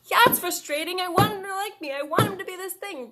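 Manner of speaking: put-on high, loud little-kid voice, upset; inner child voice. Shown as a demonstration. Therapist voicing her inner child, a high, upset little kid. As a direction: speak as an upset five-year-old, high and loud. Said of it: "having your five-year-old voice".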